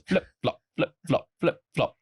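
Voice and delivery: popped, evenly spaced flip-flop sounds; flip-flop noise. Shown as a demonstration. Showing the noise the sandals make when worn, imitated flip-flop. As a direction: imitate sandals slapping along. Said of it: "they make a flip-flop noise".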